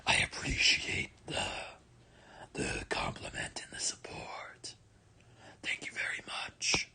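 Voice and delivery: soft whispered thank you; hushed grateful whisper. Real, not acted. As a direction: breathe the thanks out softly. Thanking viewers quietly.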